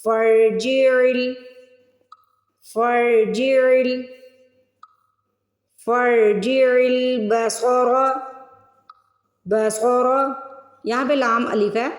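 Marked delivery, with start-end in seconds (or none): 0.0-10.1 each word recited very slowly, set apart; slow tajweed drill; slow recitation drill. Shown as a demonstration.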